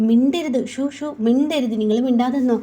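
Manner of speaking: high, loud shushing command; angry hush. Acted. Nandini loudly shushing Surya, angry. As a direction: shut him up sharply, shushing.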